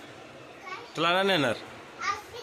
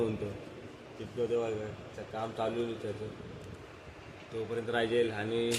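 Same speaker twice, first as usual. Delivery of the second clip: softer, hushed words to the camera; hushed aside; 5 semitones lower, 8 dB quieter than usual. Real, not acted. Mother quietly tells viewers about the boy's surprise, hushed.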